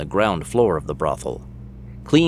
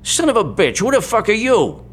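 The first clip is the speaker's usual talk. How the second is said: sleazy boss caught off guard; startled sleazy guy; 13 semitones higher than usual. Acted. Green startled and angry.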